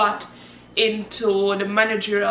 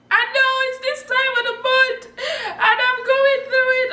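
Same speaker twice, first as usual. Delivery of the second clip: laugh-crying; 12 semitones higher than usual. Retold or imitated. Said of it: "I'm like".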